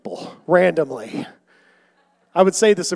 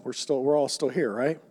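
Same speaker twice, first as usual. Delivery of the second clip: ordinary talk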